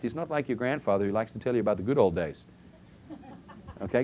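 ordinary talk, nothing marked

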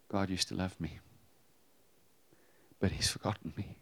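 soft, hushed hurt, lightly acted; understated tearful hush; retold crying. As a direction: quote a crying wife quietly, almost whispering the end. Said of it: "crying, and said".

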